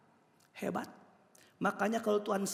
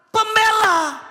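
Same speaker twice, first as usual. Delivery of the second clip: high, loud proclamation; big high pulpit cry; emphatic shouted answer; 14 dB louder, much slower than usual. Real, not acted. Answers own question to congregation.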